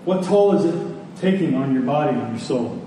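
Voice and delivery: normal talking voice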